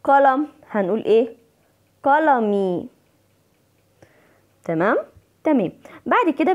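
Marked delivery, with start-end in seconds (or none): none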